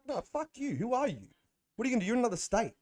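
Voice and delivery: high angry retold yell; retold screaming back. Retelling a fight with an app troll, a high angry yell. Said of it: "So I started screaming back".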